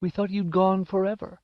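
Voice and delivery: high, tearful relief, lightly acted; mother overcome at her son's return; sobbing mother. A narrator's reading. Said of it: "she sobbed".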